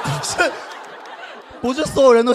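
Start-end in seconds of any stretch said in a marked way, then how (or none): none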